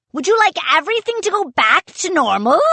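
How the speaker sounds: high, fast wheedling offer; a pirate captain tempting the boys. A narrator's reading. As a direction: dangle the bait eagerly.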